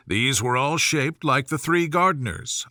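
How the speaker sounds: ordinary delivery, nothing marked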